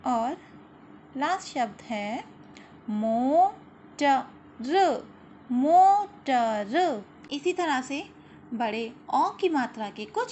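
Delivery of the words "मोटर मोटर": मोर loud, the second slowly drawn out; read-along word. Shown as a demonstration. Teacher reading words aloud for children to repeat, slow and loud. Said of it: "मेरे साथ आप लोगों को भी पढ़ना है".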